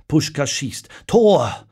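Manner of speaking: sportcommentator roept het doelpunt; doelpuntkreet. A narrator's reading. Radiokommentar beim Tor der Ungarn.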